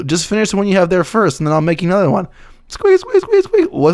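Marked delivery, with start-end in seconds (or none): 2.7-3.7 high, tight squeaks; squeaky grape voice. Pretend. Answering as the grape, high playful squeaks.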